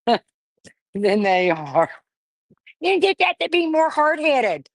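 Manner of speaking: laughing. Real, not acted. Laughing after getting the suture out.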